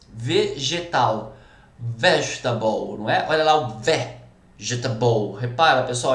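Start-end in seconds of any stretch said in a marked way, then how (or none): 1.8-3.0 vegetable in English, low, slow, stressed on VE; English pronunciation. Shown as a demonstration. Teacher giving an English example, English pronunciation. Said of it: "como que é vegetal em inglês?". 3.5-5.4 English vegetable split after a stressed VE; first-syllable stress. Shown as a demonstration. Teacher pointing out the fourth-from-last syllable, first-syllable stress. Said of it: "é a sílaba tônica".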